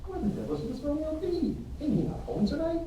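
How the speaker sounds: Mercutio, pitched high and mocking; a distinct high voice for Mercutio, calling for Romeo; high, jeering young gallant. Mercutio voiced apart from Friar.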